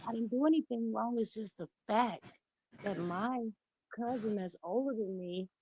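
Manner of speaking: usual speaking voice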